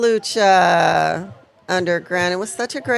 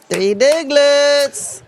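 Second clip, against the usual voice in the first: slow drawn-out name; 6 semitones higher, much slower than usual. Acted. Repeats name slowly.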